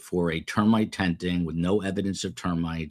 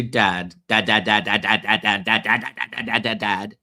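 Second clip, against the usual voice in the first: mock kids repeating dad, louder; nagging kids again; 4 dB louder than usual. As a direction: hammer the word like impatient children. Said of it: "when I said to my kids".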